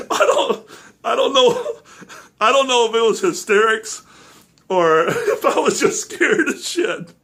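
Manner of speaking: laughing through words. Real, not acted.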